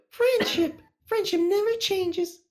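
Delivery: very high, slow cartoon pony voice; squeaky little pony, drawn out. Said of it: "you gotta say it with, like, a pony voice".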